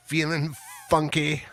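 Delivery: high, playful showman lilt; funky voice. Acted. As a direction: sing it out like you're grooving. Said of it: "trying to be a little bit more funky".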